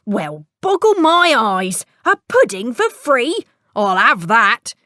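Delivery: a hungry tinker gloating over his find; greedy tinker. Acted. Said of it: "said the tinker".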